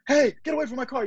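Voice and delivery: mild mock yell; mock warning. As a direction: chase the thief off. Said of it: "if they're like".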